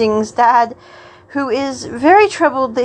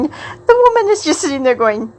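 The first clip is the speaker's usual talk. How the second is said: ordinary delivery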